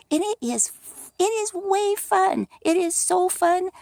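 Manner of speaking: high, bubbly squeal of fun; excited gushing about writing a book; giddy delight. Real, not acted. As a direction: let the joy lift the voice way up.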